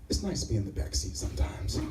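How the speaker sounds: low, soft, dreamy line; soft dreamy musing. Retold or imitated. Roommate musing in the back seat, soft and dreamy. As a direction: gaze out the window, wistful.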